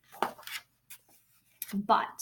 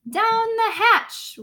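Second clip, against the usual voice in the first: much higher, slow crew chorus; squeaky crew cheer; crew shouting; 8 semitones higher, 6 dB louder, much slower than usual. Acted. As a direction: echo it like a rowdy crew. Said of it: "we all shouted".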